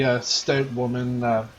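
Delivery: usual speaking voice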